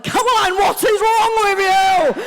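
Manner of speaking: high, loud, slow shouted what is wrong with you; partner yelling. Retold or imitated. Comic voicing angry partners shouting at her, high and loud. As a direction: scream it like a furious partner. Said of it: "partners were like".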